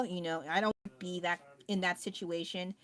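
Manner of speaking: ordinary talk, nothing marked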